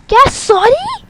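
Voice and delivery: put-on older sister shrieks at the girl who stole her money; outraged shriek. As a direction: shriek at the betrayal. Sister discovers theft, outraged.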